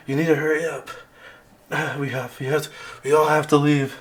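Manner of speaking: pressing, anxious warning; urgent. Acted. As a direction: urge them out, sighing between phrases. GM voices Winter urging escape.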